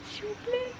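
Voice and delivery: high squeal. Real, not acted. Gushing over the garden.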